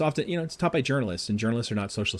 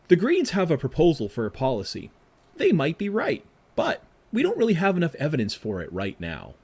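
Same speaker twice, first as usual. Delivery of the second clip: higher and louder, like a measured political ad; mock campaign ad voice; 8 semitones higher, 5 dB louder than usual. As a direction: read it as a sensible official ad announcer, pitched up. Retold Australian political ad.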